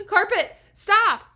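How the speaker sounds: shout. A narrator's reading. Girl yelling at the plunging carpet, full-throated shout. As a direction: scream it to stop the fall. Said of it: "Sabrina managed to shout".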